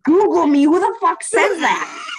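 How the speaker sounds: loud, high outraged mockery; incredulous ranting shout; livid. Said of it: "And then I was livid".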